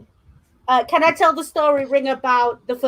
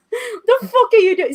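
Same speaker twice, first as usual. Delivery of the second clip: higher, laughing through the words; laughing disbelief; 9 semitones higher than usual. Retold or imitated. Housemate laughing at Ring's bra attempt, incredulous. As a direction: crack up while asking.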